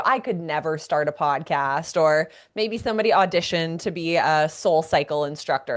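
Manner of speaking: usual speaking voice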